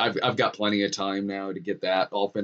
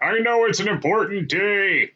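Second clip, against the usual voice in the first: Harrison Ford impression; 10 semitones higher than usual. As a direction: do your best Harrison Ford. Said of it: "got to be like".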